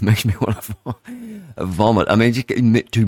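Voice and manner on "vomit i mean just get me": ordinary talk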